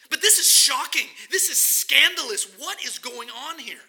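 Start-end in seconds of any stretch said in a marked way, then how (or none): 0.1-1.1 loud, high, hammered home; emphatic exclamation. Acted. Preacher to congregation, insisting it is shocking, loud and high. 1.3-2.5 loud, raised pitch, a cry of outrage; emphatic exclamation. Acted. Preacher telling the congregation it is scandalous, loudly.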